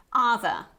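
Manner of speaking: other in a British accent, final R silent; British variety. Shown as a demonstration. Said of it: "we don't pronounce this R at the end".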